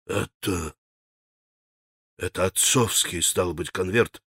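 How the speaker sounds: low, soft muttering with halting pauses; stunned mumble. A narrator's reading. Mitya stares at the father's torn envelope, muttering. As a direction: mumble it haltingly under the breath. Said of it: "пробормотал он".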